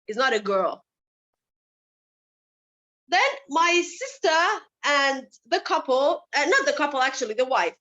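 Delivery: ordinary delivery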